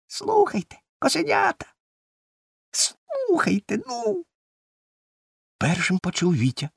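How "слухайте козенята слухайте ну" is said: tiny, very high creature voice; thin squeak. A narrator's reading. Tiny creature calls the kids from the corner, thin squeaking. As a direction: squeak insistently for attention. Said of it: "тоненький писк".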